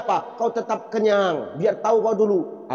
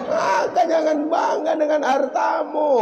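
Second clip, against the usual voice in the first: much higher, slow, forceful warning; raised, slow pulpit cry; preaching shout; 10 semitones higher, slower than usual. Real, not acted. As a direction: drive the warning home. Preacher warns congregation.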